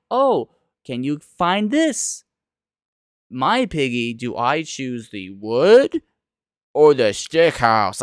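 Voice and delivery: slow, lightly voiced preschool-show questions; mock Dora-style host. Vlogger mocking a children's show, slow playful voice. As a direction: ham up a kids' show asking the viewer. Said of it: "a Dora type-esque where it's like".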